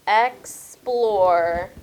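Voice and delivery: "Explore" loud, stretched in two beats; syllable by syllable. Shown as a demonstration. Teacher reading back her word, "Explore" loud and segmented. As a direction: read "Explore" out firmly as ex, plore. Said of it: "let me read my word".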